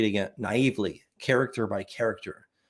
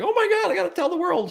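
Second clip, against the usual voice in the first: much higher, fast excited gush; faster than usual. Retold or imitated. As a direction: gush like a huge discovery. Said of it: "'cause I was like".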